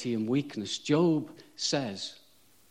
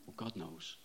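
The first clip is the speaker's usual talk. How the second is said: dismissive brush-off; 8 semitones lower, 15 dB quieter than usual. Pretend. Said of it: "trite religious speak".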